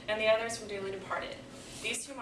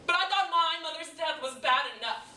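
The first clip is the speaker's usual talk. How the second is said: raised, higher-pitched grief; pained protest; 8 semitones higher, 8 dB louder than usual. Acted. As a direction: push out the hurt. Monologue, grief turning to protest.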